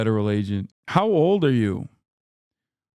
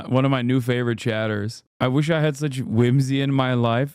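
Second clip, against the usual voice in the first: ordinary talk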